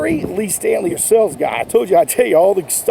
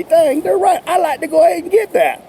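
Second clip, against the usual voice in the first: higher, eager customer voice; convinced customer; 8 semitones higher than usual. Retold or imitated. Customer won over by an enthusiastic seller, eager. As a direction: sound sold on it and ready to buy. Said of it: "so the customer can say".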